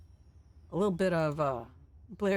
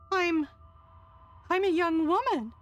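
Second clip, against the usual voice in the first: tearful joyful woman; 8 semitones higher than usual. Acted. Crystal overjoyed at youth.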